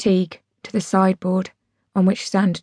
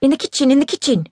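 higher, impatient repetition; irritable; 6 semitones higher, faster than usual. A narrator's reading. Dad ordering daughter to fetch a drink, irritable. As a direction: snap it at a child who should know. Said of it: "he says irritably".

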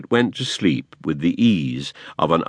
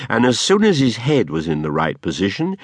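plain reading voice

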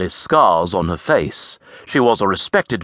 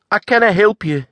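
high, lilting Scots lass; Avril's voice; 14 semitones higher, 4 dB louder than usual. A narrator's reading. Scottish lass answering the stranger. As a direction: voice the saucy Scottish farm girl.

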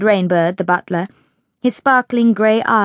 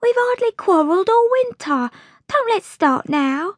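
very high, pleading maid; upset scullery maid appealing gently; distressed; 12 semitones higher than usual. A narrator's reading. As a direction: beg for peace in a high little voice.

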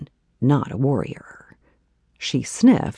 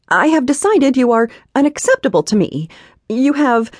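high, loud, fast imperious dismissal; haughty heroine; 10 semitones higher, 7 dB louder, faster than usual. Acted. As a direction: reject him with icy superiority. Heroine voiced in the story.

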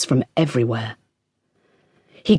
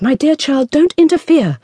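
much higher, louder, sharp and scared; cross and frightened; 12 semitones higher, 5 dB louder than usual. A narrator's reading. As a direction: snap at her daughter out of fear. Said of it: "quite crossly, for she was frightened".

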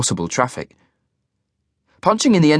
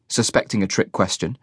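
ordinary delivery

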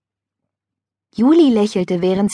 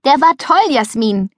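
high, louder cheer; excited praise; 5 semitones higher, 4 dB louder than usual. A narrator's reading. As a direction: cheer her on after the dive. Cheering a friend's dive.

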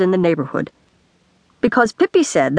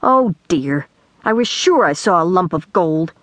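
usual speaking voice

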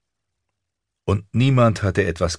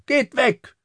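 very high defiant shriek; child's high-pitched angry cry; screaming. A narrator's reading. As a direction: yell to drive them away. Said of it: "schrie Aryeh".

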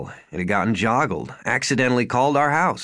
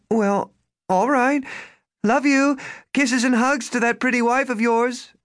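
doting mother; 12 semitones higher than usual. Acted. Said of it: "Look, Mom".

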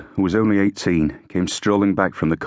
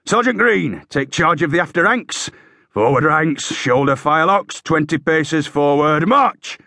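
high parade-ground commands, peaking on march; high sergeant's shouting, last order drawn out; bellowed orders; 9 semitones higher, 3 dB louder than usual. A narrator's reading. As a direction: bellow drill orders down the ranks. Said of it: "Hakeswill bellowed".

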